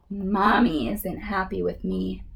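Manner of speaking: plain reading voice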